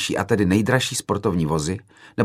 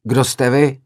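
loud, higher, fired-up challenge; heated shout; 6 semitones higher, 6 dB louder, slower than usual. A narrator's reading. Film-maker to the hall, heated shout. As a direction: fire the question out at the crowd. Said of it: "zařval jsem rozpáleně do sálu".

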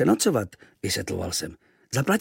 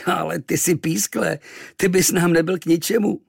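mocking; 7 semitones higher, 4 dB louder than usual. Sailors mock the boy.

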